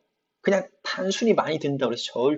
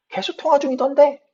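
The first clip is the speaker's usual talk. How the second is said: high, louder puzzled protest; puzzled complaint; 13 semitones higher, 6 dB louder than usual. Acted. Caller explaining the line was busy, high puzzled complaint. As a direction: act baffled, pitched well up.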